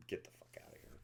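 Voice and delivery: hushed get-out-of-here; whispered scoff. Real, not acted. Mocking the film's dialogue, in a whispered scoff. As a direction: whisper it in disbelief, under your breath.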